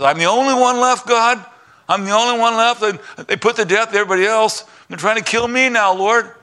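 high, pleading self-pity; whining prophet. Acted. Elijah complaining to God he's the only one left, high whiny plea. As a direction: plead your case, high and sorry for yourself.

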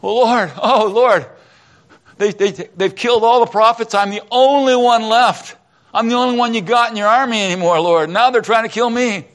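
high, loud self-pitying wail; despairing prophet crying out to God; whining Elijah. Retold or imitated. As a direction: plead like a frightened prophet.

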